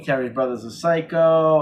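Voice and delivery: ordinary talk, nothing marked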